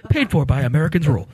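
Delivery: rushed low disclaimer read; fast ad disclaimer. Retold or imitated. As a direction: rattle off the fine print like an ad.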